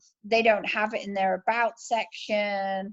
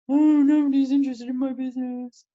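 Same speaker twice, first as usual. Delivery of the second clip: louder, fast mock self-pitying whine; mopey whine; 4 dB louder, faster than usual. Seller moaning that nobody wants their business, mock whine. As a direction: moan like a sulky seller. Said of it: "Don't be".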